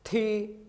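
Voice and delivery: थी said high with a rising second tone. Shown as a demonstration. Teacher demos थी to Hindi learners, high rising tone. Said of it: "हम इसको ऊंचा पढ़ेंगे".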